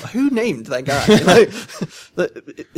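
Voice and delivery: ordinary delivery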